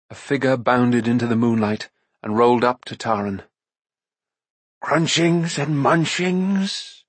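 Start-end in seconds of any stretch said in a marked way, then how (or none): 4.8-7.0 slow, high, whining little beast; whimpering creature. A narrator's reading. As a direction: whine it out like a hungry forest creature. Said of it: "whimpered a voice".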